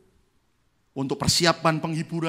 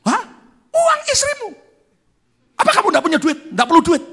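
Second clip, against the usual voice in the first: very high, loud, laughing disbelief; incredulous lawyer; 12 dB louder than usual. Retold or imitated. Lawyer amazed the pastor turns down the money, laughing in disbelief. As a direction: squeak out astonished disbelief.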